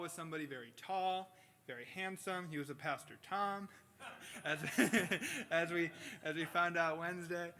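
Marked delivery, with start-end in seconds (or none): 4.4-5.5 bursting into a loud laugh mid-sentence; real laughter. Real, not acted. Preacher laughing at his joke about Pastor Tom, real laughter. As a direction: laugh at your own joke.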